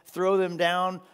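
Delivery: ordinary talk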